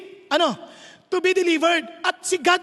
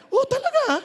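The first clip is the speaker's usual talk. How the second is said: mock surprise; 8 semitones higher, much faster than usual. Acted reply in retold chat.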